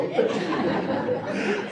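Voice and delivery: soft breathy chuckle; laughing. Real, not acted. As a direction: laugh quietly at the irony. Laughs after Mueller punchline.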